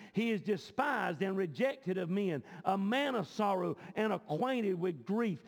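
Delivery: declaimed Bible verses in measured phrases; scripture reading. Real, not acted. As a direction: read it out to the congregation, weighting each phrase. Reads scripture aloud to congregation.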